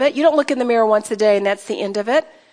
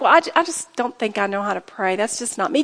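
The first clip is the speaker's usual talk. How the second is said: mock doubter; 5 semitones lower, 5 dB quieter than usual. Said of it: "Do you feel like".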